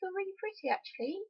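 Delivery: sweet, condescending fake reassurance; high sugary mock compliment. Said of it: "To say to someone".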